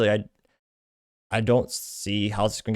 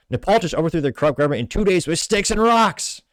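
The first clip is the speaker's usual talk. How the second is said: raised, emphatic movie-quote yell; mock indignant outburst; 7 semitones higher, 4 dB louder than usual. Meme character berates someone over Nepal, mock indignant outburst. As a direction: build it up, hammering the last words. Voices the meme's exasperated shouted line.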